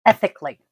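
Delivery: emphatic standalone "ethically", dropping at the end; emphasis on ethically. Real, not acted. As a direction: land firmly on "ethically" as a one-word reminder. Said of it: "emphasis on the word ethically".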